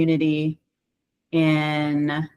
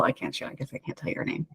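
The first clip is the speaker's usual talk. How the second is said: a hushed whisper, far softer; whispered aside; 4 semitones lower, 13 dB quieter, faster than usual. Real, not acted. Holding back the resident's name in a hushed whisper.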